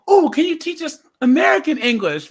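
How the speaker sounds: high, loud excited pupils' plea; eager kids. Retold or imitated. Kids asking visiting American for lessons, acted eager. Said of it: "all the kids are like".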